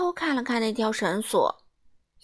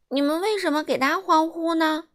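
higher, indignant calling out; baffled protest to the crowd; loud puzzled complaint; exasperated cry; 7 semitones higher, 4 dB louder than usual. Acted. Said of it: "她叫着说".

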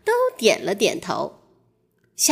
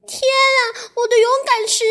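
high, frightened little mouse; frightened cry; 14 semitones higher, 3 dB louder than usual. Acted. Mouse realises in the water the stone is gone, frightened cry. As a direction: cry out in sudden alarm. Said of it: "小老鼠惊恐地叫道".

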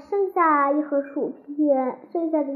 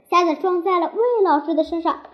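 usual speaking voice

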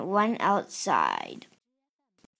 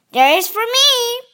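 stubborn retort; 13 semitones higher, 9 dB louder, faster than usual. A narrator's reading. Franklin arguing in his tantrum.

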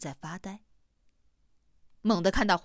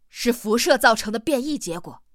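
firm, louder answer; resolute; 2 semitones higher, 7 dB louder than usual. A narrator's reading. Hamsen answers Huaite's question, resolutely and loudly. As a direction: state it with full certainty. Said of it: "回答得很坚决".

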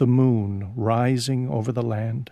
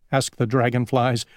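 usual speaking voice

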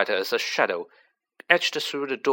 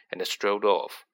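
ordinary delivery

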